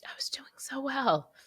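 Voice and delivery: whispered through real tears; tearful whisper. Real, not acted. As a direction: whisper it while starting to cry. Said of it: "on the interwebs crying".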